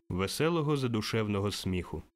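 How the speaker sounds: ordinary delivery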